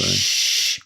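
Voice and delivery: long "shh" to hush a mate; mock-secretive shush; playful shushing. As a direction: hush them with a drawn-out shh. Jokingly hushing co-host's slip.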